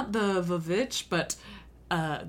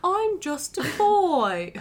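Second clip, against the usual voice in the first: cartoon boy voice; 8 semitones higher, much slower than usual.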